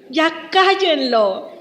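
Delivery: drawn-out, exasperated hush-him-up; slow, irritated complaint; fed-up crowd. Acted. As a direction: groan it slowly like an annoyed bystander. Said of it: "lo mismo que pensó la gente".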